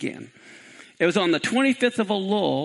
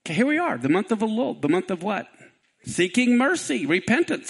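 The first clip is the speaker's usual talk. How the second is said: ordinary delivery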